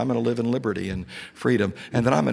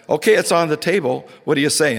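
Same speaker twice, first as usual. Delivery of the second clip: excited and higher, urging him on; eager loud prompt; 6 semitones higher, 6 dB louder than usual. Retold or imitated. Speaker urging the wronged colleague to answer, excited and loud. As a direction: call the question out across the room.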